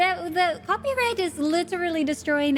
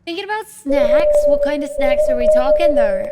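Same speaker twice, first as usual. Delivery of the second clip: loud; 10 dB louder than usual. Real, not acted. Reacting loudly to snack talk.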